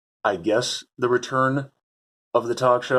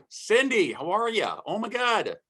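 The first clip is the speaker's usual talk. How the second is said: much higher, delighted hello; excited greeting; 12 semitones higher, slower than usual. Real, not acted. Greeting his college friend Cindy on Zoom, thrilled and high-pitched.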